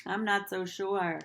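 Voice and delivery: ordinary delivery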